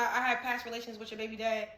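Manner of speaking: normal talking voice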